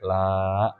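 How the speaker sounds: stretched-out "la"; LA two beats. Shown as a demonstration. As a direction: hold "la" long for two beats. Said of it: "kalau nanti la, dua". (la panjang dua ketukan)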